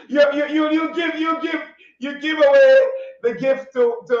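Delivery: high, loud excited stammer. Real, not acted. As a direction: get excited and trip over words. Excited teasing about money.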